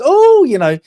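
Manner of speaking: high, loud, over-the-top oh; mock gushing. Selling the romance to the Americans with a mock gushing oh. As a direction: ham up the sugary sales pitch.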